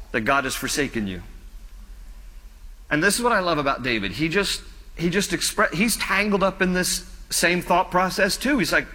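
ordinary talk

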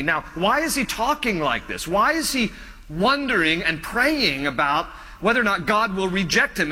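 normal talking voice

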